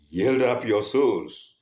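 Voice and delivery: slow, loud, menacing command; horrifying angel voice. Acted. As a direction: boom it out like a terrifying angel. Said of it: "in a horrifying voice".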